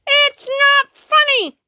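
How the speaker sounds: slow, loud, hurt shout on not; upset shout. Acted. Kid shouting at the mocking cat, slow and loud. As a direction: yell it out hurt.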